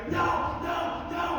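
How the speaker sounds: high, loud retold shouting of No, no. Shouting at himself in the mirror before the fight, loud. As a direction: yell it out like pumping himself up. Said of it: "I'm shouting in the mirror".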